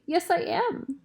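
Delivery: high, lightly acted sob; sobbing. A narrator's reading. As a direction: pitch it up, mildly tearful. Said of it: "she sobs".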